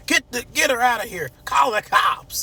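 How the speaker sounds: high-pitched mock shouting; high, urgent order to throw her out; Martin. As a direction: yell it in a high, alarmed impression. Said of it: "That's how he talk".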